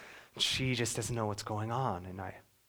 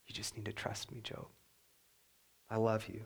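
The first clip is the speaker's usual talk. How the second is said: soft reassurance; 7 dB quieter than usual. Retold or imitated. God reassuring Job.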